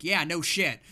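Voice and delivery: loud, sarcastic retort; sarcastic no shit. Retold or imitated. Listener reaction, louder, higher.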